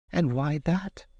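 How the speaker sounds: lightly voiced young lass; a hint of a Scots maid. A narrator's reading. Maid's voice in story.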